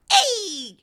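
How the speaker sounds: a high, hammed-up Italian-American "Hey!"; imitating a gangster accent; mock Godfather accent. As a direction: toss out a cheesy mob-boss hey. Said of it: "the accent that he did".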